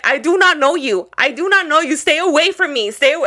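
acting out yelling at a stranger; retold yelling. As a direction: call it out, each word punched. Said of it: "I started yelling".

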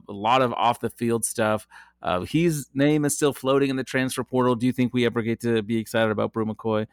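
normal talking voice

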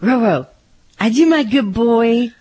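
high, loud cooing at the dog; baby talk. Shown as a demonstration. Co-host tries baby talk on her dog, high and cooing. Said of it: "that's pretty good".

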